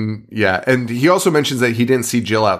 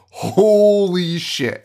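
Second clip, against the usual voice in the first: very drawn-out, higher amazement; awed revelation; 8 semitones higher, much slower than usual. Retold or imitated. Trevor taking in the pure revelation, stunned. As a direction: stretch each word in mock astonishment. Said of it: "the most pure revelation".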